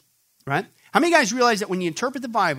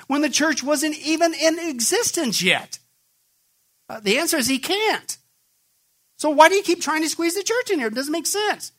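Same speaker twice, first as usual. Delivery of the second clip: high, incredulous challenge; exasperated preaching; 9 semitones higher than usual. Acted. Preacher challenging his listeners, high and exasperated. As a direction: demand an answer, voice up high.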